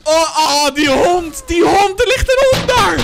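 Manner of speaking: loud, rising shout of alarm; real startled yelling; shocked outcry. Real, not acted. Spots dog in clip, shocked.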